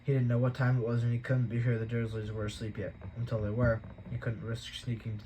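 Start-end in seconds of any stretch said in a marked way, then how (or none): none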